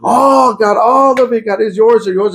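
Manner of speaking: high, tearful cry of surrender; crying out to God. Retold or imitated. Face down in worship, tearfully surrendering it all to God. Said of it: "oh, crying, crying".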